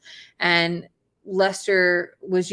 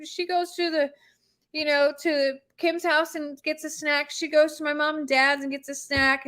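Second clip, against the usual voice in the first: retold amused reassurance; 7 semitones higher than usual. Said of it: "that he's laughing. He's like".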